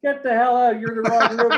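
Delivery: loud, high-pitched yell at an intruder; retold angry shout. Guest to returning drunk at night, angry shout. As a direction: yell at him to leave, amused retelling.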